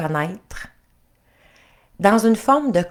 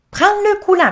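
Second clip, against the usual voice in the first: "Prends le nœud coulant" pitched very high and louder; shrill repeated order; 7 dB louder than usual. Acted. Princess repeating her order to the prince, very high and loud. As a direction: repeat it, climbing into a shriek.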